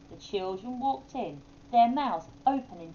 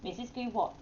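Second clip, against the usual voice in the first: usual speaking voice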